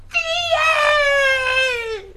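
very high, drawn-out holler; shouting pig. Acted. Pig calling to Theo from tube, shouting. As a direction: squeal the name at the top. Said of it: "she shouted".

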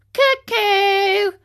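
high, loud drawn-out cuckoo call; mocking. A narrator's reading. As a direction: taunt them like a cuckoo bird. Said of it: "mocking them".

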